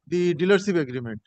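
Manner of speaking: normal talking voice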